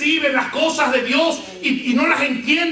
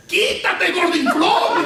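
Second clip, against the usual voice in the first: put-on child's yell; 6 semitones higher, much slower than usual. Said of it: "y gritó delante de todos".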